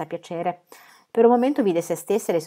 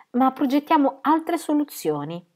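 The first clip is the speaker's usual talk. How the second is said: determined; 8 semitones higher than usual. Acted. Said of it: "parlando con grande determinazione".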